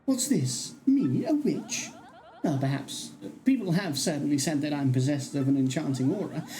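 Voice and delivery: theatrical, swooping character voice; flirtatious enchantress. Acted. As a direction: gush like a flattered, self-admiring charmer. Voices a flattering game character.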